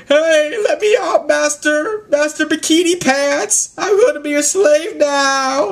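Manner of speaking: very high, loud mock begging; pleading captive. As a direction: squeal it out like a trapped weasel. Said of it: "And Corbin's like".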